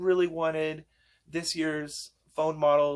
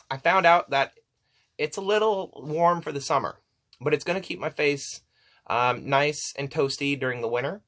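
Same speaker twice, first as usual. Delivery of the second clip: ordinary talk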